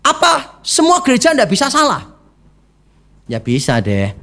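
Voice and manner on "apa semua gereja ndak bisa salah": high, insistent challenge; stubborn protest. Retold or imitated. Rebuked member defies the church's verdict, high insistent protest. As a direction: defy the whole church. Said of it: "tetap dia bilang gini".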